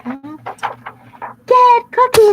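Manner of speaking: very high, slow loud shout. Acted. Bad boys pouncing on cookie, shouting. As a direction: yell the charge. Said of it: "they shouted".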